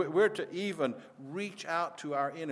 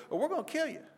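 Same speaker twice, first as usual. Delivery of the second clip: threat leaps much higher at the end; mock threat; faster than usual. Preacher voicing extremists' final threat, hammed-up high menace.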